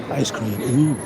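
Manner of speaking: ordinary delivery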